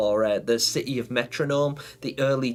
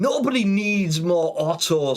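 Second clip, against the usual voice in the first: higher, drawn-out mock protest; mock exasperation; 4 semitones higher, 3 dB louder, slower than usual. Streamer answering a viewer's wish, mock exasperated. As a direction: playfully shoot the idea down.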